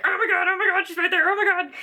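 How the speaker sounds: high, loud starstruck gushing; fangirl squeal. Retold or imitated. Fan spotting her favorite author, starstruck squealing. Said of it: "trying not to be like".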